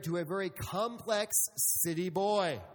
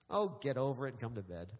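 a hint of a dismissive wife, lower and softer; lightly voiced wife, low; 8 semitones lower, 5 dB quieter, faster than usual. Husband imitates his wife shrugging him off, low and soft. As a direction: toss it off like a sleepy wife. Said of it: "she just looks at me and goes".

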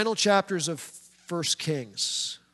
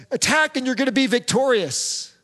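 mock triumphant, higher and louder, dripping with irony; sarcastic; 6 semitones higher, 5 dB louder than usual. Prophet Micaiah mocking King Ahab with a sarcastic promise of victory. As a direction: oversell the victory so nobody believes it. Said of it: "he said it very sarcastically".

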